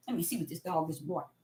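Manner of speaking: low, soft, quick aside; quiet aside. Real, not acted. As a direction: drop the voice and rush through an aside. Aside about a barking dog.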